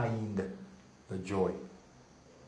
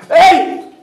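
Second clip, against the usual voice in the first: usual speaking voice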